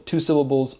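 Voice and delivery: usual speaking voice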